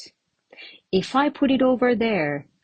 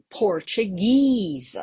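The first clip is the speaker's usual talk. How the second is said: Portuguese with GUESE drawn out; PortuGUESE, long final syllable; stress on last syllable; slower than usual. Shown as a demonstration. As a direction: lean on the end of Portuguese, slowly. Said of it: "but still on the last one".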